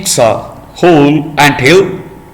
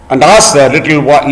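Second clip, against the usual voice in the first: ordinary delivery, nothing marked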